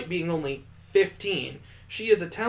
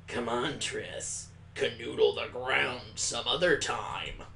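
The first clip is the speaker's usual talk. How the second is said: put-on slower, softer bored girl's voice; slow low drawl; 4 semitones lower, 4 dB quieter, slower than usual. Displeased girl telling Triss to get up, bored and slow. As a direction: drag the words, unimpressed. New character voice.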